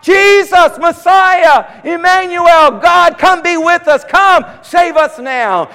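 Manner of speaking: high, loud pleading cry. Retold or imitated. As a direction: call on Jesus to come. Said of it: "They're crying out".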